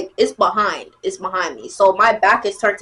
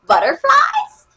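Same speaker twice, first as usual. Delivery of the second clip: very high rising question; incredulous squeak; 3 dB louder, slower than usual. Retold or imitated. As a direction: squeak it in mock disbelief. Incredulous at own feelings.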